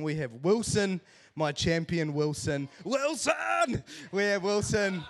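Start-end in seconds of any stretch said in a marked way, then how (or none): 2.8-5.0 high, ringing call of the name; proud call-out. Acted. Dad proudly hyping up his baby son Wilson. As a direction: announce him like a champion.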